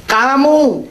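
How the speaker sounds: Kamu called out high and loud; emphatic kamu. Shown as a demonstration. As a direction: hit the word Kamu sharply, high then falling. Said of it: "kamu adalah kata ganti orang kedua jamak".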